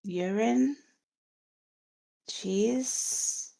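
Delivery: soft, halting corpse voice with long gaps; faint and slow, groping for each detail; dead woman answering. Acted. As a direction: give it out word by word, as if from beyond the grave. Dead Miletta describes her murderer.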